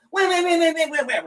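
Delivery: high, rapid-fire comic punching noises; goofy high-pitched whamming; imitated flurry of punches. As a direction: mimic wild little punches with your voice. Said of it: "comes up and just goes".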